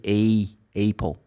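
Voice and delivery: "Apple" starting like the letter name A; mispronounced Apple. Retold or imitated. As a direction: say "Apple" as "AY-pul". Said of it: "they pronounce the letter A".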